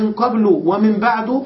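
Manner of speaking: قبل and بعد as qablu, ba'du; short u closing both قبل and بعد; fixed damma endings. Shown as a demonstration. Teacher contrasting readings, قبل and بعد ending in u. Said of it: "فقد بناهما".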